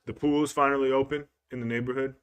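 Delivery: ordinary delivery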